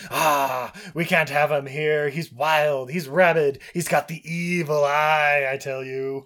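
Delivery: mock hunter warning the village; hunter ranting. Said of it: "he's like".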